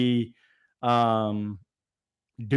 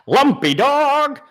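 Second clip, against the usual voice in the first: loud, drawn-out greeting; excited shout; 11 semitones higher, 7 dB louder, slower than usual. Real, not acted. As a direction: call it out big and slow.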